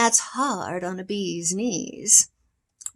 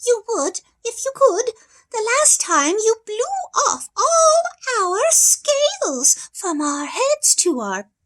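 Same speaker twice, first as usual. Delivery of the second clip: high pleading fish; 9 semitones higher, 5 dB louder than usual. Acted.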